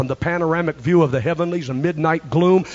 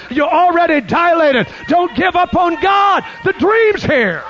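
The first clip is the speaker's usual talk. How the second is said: preaching shout; 8 semitones higher, 4 dB louder than usual. Real, not acted.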